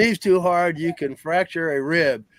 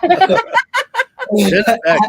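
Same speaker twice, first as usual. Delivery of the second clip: laughing; 7 dB louder, much slower than usual. Real, not acted. Laughing at Kenneth.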